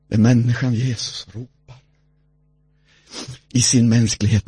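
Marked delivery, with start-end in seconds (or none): none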